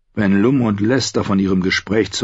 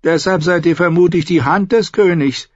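higher voiced bitter son, probing his father; a higher, defiant son testing his father; Tyrion's voice; 11 semitones higher, 3 dB louder than usual. Son asking his father about punishment, higher defiant voice.

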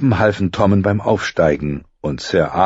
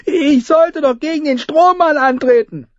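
put-on high whiny little boy; young boy voice; 4 dB louder than usual. A narrator's reading. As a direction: complain like a small prince.

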